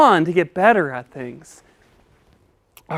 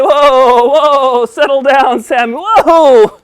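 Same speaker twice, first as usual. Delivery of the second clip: loud, raised-pitch whoa; calling to order; 6 semitones higher, 10 dB louder, slower than usual. Pretend. Teacher settling the excited puppet down, loud and insistent. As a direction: rein in an overexcited pupil loudly.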